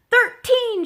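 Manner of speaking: high, loud triumphant shout; yelled answer. A narrator's reading. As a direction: yell the answer before anyone else. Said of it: "she yelled".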